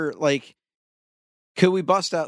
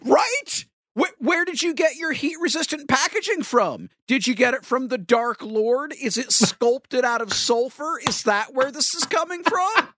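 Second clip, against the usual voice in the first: excited; 8 semitones higher than usual. Pretend. Mock paranoid rant.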